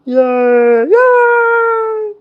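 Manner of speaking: very high squeaky cheer; Muppet cheer. Acted. Cheering for the group after Kermit clip, very high squeaky yay.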